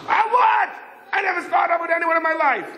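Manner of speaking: high-pitched, offended protest; indignant denial. Retold or imitated. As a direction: protest your innocence in a squeal. Yossel indignantly denies the accusation.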